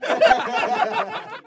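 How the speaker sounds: mocking forced cackle; bully's laugh. A narrator's reading. Class bully after mocking Irish boy, jeering laugh. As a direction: laugh at your own jeer.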